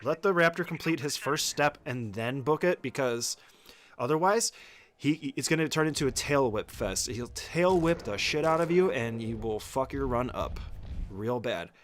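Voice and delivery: ordinary talk, nothing marked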